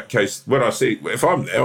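ordinary talk, nothing marked

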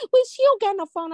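high, loud imitation of a daughter; mimicked daughter.

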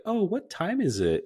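breathy high flirty voice. Retold or imitated. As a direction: swoon over a simple question, way up high. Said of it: "they're like".

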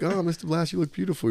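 sweet compliment. Retold or imitated. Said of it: "I'm like".

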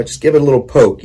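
ordinary talk, nothing marked

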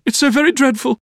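put-on very high girlish wail of dismay; distressed cry. A narrator's reading. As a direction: lament in a high frightened voice. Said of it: "cried Dora".

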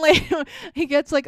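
ordinary talk

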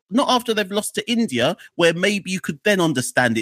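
high-pitched incredulous questioning; acted indignant backlash. Fans' backlash over the mid-Games announcement, acted high-pitched indignation. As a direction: challenge her, pitched well up.

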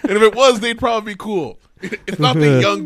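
loud, high, laughing denial; laughing protest. Real, not acted. Teacher denying the Uggs joke to her friend, laughing loudly.

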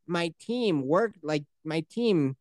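slow, soft recalling the line; mock slow villain line; slow quoted line. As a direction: drag it out, searching for the words. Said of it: "the quick line was".

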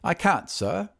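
formal servant's refusal with a light character touch; butler. Acted. Voices the butler refusing a caller.

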